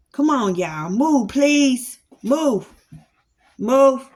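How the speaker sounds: firm shout at the dogs; shooing dogs. Real, not acted. Irritated owner ordering dogs away from his ankles, loud firm commands.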